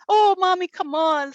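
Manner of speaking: put-on pleading little girls. Daughters begging mommy to keep reading, high whiny plea. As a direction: beg like a child who won't sleep.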